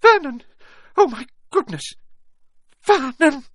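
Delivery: very high, loud panicked cries; choking shock. A narrator's reading. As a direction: gasp it out as if about to faint. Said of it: "clutched her throat and made a choking noise".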